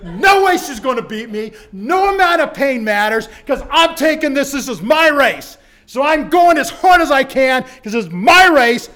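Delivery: loud, driven shouting of resolve; racing for the line, shouting with determination; fierce determination. Retold or imitated. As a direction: push every word like the last stride. All-out sprint to the finish.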